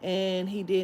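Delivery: usual speaking voice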